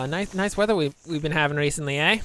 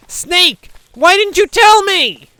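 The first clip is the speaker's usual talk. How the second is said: high, loud shout at the snake; mock outraged yell; 14 semitones higher, 13 dB louder, slower than usual. Player yelling at the snake teammate, mock furious shout. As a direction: blow up at him in mock fury.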